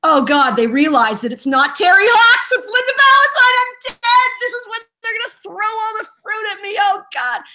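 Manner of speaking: high, panicked, voice climbing and cracking; imitating her own terror at being found out; panicked inner voice. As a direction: voice actor recalling a panicked thought before her fans. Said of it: "And I went".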